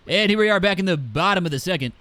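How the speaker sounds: loud, high, fast announcer call; mock a stuffed-up commentator with twisted vowels; congested sports announcer. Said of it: "always slightly congested and put an A".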